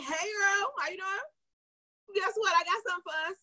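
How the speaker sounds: put-on high, bright singsong hello to a girlfriend; high bubbly greeting. Texting her girlfriend, a high, excited singsong hello. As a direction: pitch it way up like greeting your best friend. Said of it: "And I'd be like".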